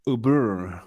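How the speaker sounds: Aubourg as a drawn-out "oo-BOOR"; mock French pronunciation. Host guesses co-host's home town, Aubourg as French-style oo-BOOR. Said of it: "it's a very Indiana pronunciation of that town".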